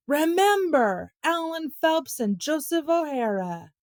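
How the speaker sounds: high, slow, calling yell; drawn-out yell. A narrator's reading. Tom calls to the neighbourhood while running off, high drawn-out yell. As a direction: stretch it out as if calling down the street. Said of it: "Tom yelled".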